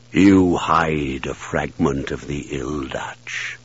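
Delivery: low, slow booming druid; deep commanding wizard voice; mystical druid voice. Acted. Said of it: "the druid's voice thundered out of Jer".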